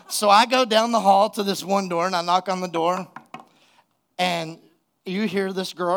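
normal talking voice

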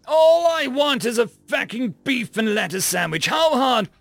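furious, high and loud; angry yell. A narrator's reading. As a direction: lose your temper at a machine. Customer yells at dispenser.

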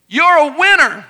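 high, loud proud holler; acted-out dad's call. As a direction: shout it out to the mountains. Dad calls out to make echo.

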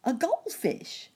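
high quick cricket answer; chirping cricket voice. Acted. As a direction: chirp it out fast. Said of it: "said the cricket quickly".

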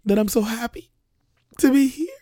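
lost voice cracking up high. Real, not acted. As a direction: voice breaks as it strains upward. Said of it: "I can actually hear that my voice is gone".

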